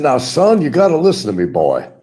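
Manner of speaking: high, loud blustering rooster lecture; booming Southern rooster bluster; Foghorn Leghorn. Retold or imitated. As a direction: scold the boy like the cartoon rooster. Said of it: "Foghorn Leghorn".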